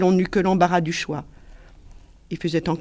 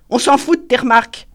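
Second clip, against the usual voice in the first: higher, louder dismissive snap; rude woman; 6 semitones higher, 8 dB louder than usual. A narrator's reading. Chantal dismissing Paul, high loud snap. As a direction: brush him off.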